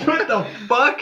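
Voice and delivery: high, loud, incredulous what the fuck; mock your own total confusion; baffled retort. Retelling his reaction to a teammate's slang, high and baffled. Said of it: "And I'm like".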